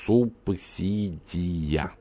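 субсидия drawn out syllable by syllable; spelled out slowly. A narrator's reading. Writing word slowly on glass.

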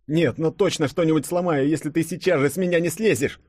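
high-pitched, whining protest; wailing complaint. A narrator's reading. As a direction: lament from under a heavy body. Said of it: "запричитал Денис". (жалобное причитание на высокой ноте)

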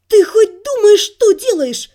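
loud, high furious scolding; angry. Acted. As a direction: snap at him in outrage. Furious outburst at Kit.

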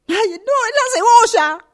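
loud high sobbing cry; fake crying over onions. Woman caught crying on the phone, loud sobbing. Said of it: "¿Te das cuenta que estoy picando cebolla?".

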